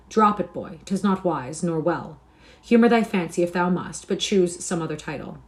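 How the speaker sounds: mildly firm advice; kindly but firm correction; firm but good-natured. A narrator's reading. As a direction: advise the boy firmly, without malice. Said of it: "said firmly, but with an accent of good nature".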